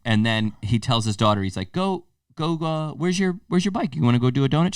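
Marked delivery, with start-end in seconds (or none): 1.7-4.7 lightly voiced dad, pitched up; imitated encouraging dad. Dad telling his daughter to go ride, imitated encouragement. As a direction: coax a kid along in a higher voice.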